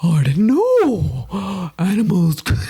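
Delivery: put-on warble it like a grand old octopus. Friend plays an octopus, slow and loud. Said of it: "is he a wizard".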